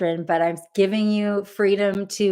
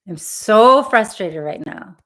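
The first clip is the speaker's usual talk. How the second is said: louder, emphatic venting; voiced frustration; 3 semitones higher, 7 dB louder than usual. Shown as a demonstration. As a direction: let the frustration out on SO. Leading tapping phrases about frustration.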